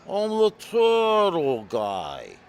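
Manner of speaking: very slow, loud, high Jersey announcement; Jersey tough guy. Retold or imitated. Jersey caller telling who he is, hammed-up tough guy. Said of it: "some guy from Jersey".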